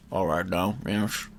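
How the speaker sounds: low, slurred Scottish actor; Sean Connery. Acted. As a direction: take direction in a low Scottish slur. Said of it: "Okay, so Sean".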